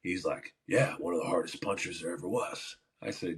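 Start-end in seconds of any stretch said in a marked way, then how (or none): 0.7-2.8 lightly voiced older man; a hint of an old-timer, slightly low and soft; older coach. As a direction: imitate a low-key coach reminiscing. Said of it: "it was an older man".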